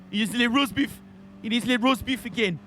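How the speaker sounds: silly put-on French accent; terrible French accent. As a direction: tease like a bad French impression. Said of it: "That's a terrible accent".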